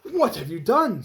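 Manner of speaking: slow, loud outraged question; a mayor aghast at his ruined ceremony; angry mayor. Acted. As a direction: demand it, appalled. Mayor upset by cut ribbon.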